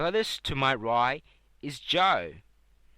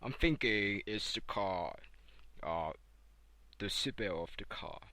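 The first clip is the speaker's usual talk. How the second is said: lower, softer male contestant; 6 semitones lower, 8 dB quieter than usual. Acted. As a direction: drop low and hesitant. Host voices contestant Mo.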